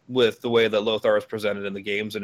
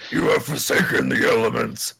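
Thrall voice; 3 dB louder than usual. Retold or imitated. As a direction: intone like Thrall. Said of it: "but in the Thrall voice".

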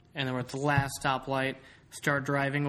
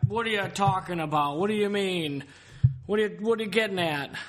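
put-on higher, louder, belligerent drunk voice; drunk friend; 7 semitones higher, 5 dB louder than usual. Mimicking drunk Jordan arguing about her driving, slurry and loud. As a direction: play the indignant whiskey drunk. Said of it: "I don't like Jordan drinking whiskey".

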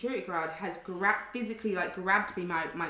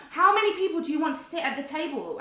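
higher-pitched, pointed demand; a bit aggressive; 7 semitones higher, 4 dB louder than usual. Retold or imitated. Waitress repeating herself to a customer, mildly aggressive. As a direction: press the question with mild aggression. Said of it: "started to get a bit aggressive".

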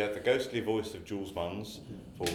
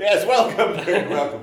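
laughing, louder and higher; 10 semitones higher, 12 dB louder than usual. Real, not acted. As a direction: laugh through the welcome. Laughing while echoing the welcome.

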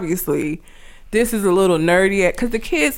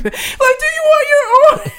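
put-on much higher, slow, teary little-kid voice; scared crying kid; 3 dB louder than usual. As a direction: imitate a terrified boy near tears. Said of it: "Scared as fuck, crying".

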